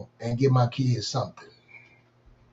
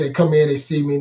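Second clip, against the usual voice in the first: usual speaking voice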